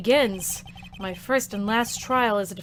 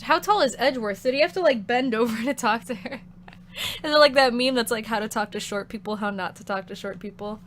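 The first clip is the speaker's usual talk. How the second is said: ordinary delivery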